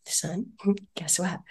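soft, slow whispered tease; gentle whisper to son. Retold or imitated. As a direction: coax him awake softly. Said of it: "I'm like".

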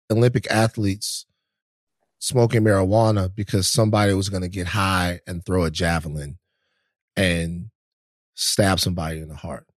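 slow the words down and drop your voice for comic effect; mocking retelling. Host teasing the guest by retelling his javelin claim, mock grave. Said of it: "That was good".

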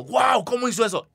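high-pitched, amazed fan reaction; acted wide-eyed astonishment. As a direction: imitate a stunned fan, voice jumping up.

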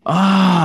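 sudden loud scare yell, high. Retold or imitated. Jumping up to scare his brother, loud yell. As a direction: jump up and yell to scare him. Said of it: "as loud as I can yell".

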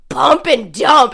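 slow pointed "pump and dump"; air quotes. Retold or imitated. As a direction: mimic the knowing "pump and dump". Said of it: "you said, air quotes".

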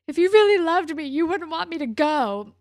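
loud, high-pitched lovers' quarrel outburst; upset accusation. Retold or imitated. Retold lovers' quarrel, girlfriend accusing.

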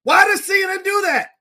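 high, loud, exasperated shout; furious outburst. Real, not acted. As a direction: blow up at the autoplay video, shouting. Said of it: "I'm literally triggered right now".

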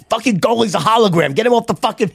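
high-pitched heckling, re-enacted; irate sideline parent. Soccer dad nearly thrown off the field, acted outrage. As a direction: replay yourself railing at the goalie. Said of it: "almost thrown off the field a bunch of times".

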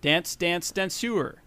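ordinary talk

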